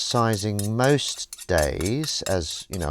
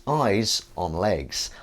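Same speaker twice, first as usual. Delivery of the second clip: slow repeat; much slower than usual. Acted. Repeats greeting more slowly.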